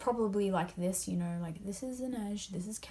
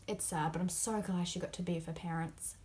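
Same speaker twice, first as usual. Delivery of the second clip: ordinary talk, nothing marked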